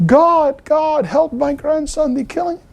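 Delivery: retold scream, high but held back in volume; imitated scream. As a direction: cry out to God in a high, strained voice, not loudly. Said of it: "He screamed with a louder voice".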